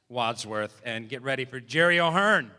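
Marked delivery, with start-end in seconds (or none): 1.6-2.5 loud build on the name; announcer shout. Real, not acted. Emcee bringing the comic on stage, loud announcement. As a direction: belt out the act's name.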